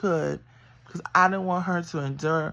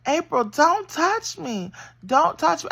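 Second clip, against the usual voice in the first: high, pleading mimic of the doctor; mock scared doctor; 9 semitones higher, 3 dB louder than usual. Mother mimics the doctor who backed away, high and scared. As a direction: imitate her high, scared protest.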